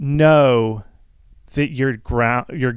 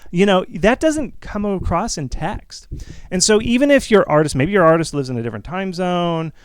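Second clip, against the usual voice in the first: usual speaking voice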